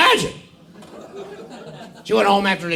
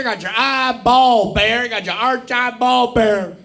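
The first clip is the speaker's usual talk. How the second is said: gleeful phonetic recital; 4 semitones higher than usual. Acted. Archibald gleefully sounding out his name for the class.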